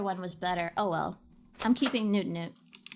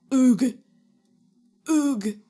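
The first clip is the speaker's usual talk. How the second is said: sudden loud caveman grunt; 11 dB louder than usual. Real, not acted. Streamer grunting at a huge walrus, loud. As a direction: blurt a big grunt.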